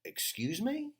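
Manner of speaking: mock offended question; mock indignation. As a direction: act put out. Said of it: "So I go".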